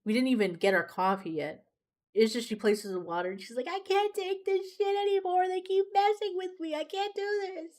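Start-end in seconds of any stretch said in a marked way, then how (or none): 3.7-7.7 very high mock wail; imitated crying. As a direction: imitate a waitress falling apart in tears. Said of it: "and she's crying".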